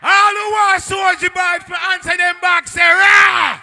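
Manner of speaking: high, very loud MC holler all through; rallying yell at top volume from the first word; full-force crowd shout. Real, not acted. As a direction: bellow it to the whole dance. MC repeats call at full force.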